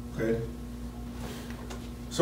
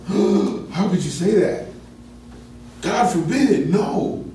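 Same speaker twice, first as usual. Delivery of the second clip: emphatic outrage; 6 semitones higher, slower than usual. Shown as a demonstration. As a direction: react as if the idea is unthinkable. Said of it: "It's an emphatic, like".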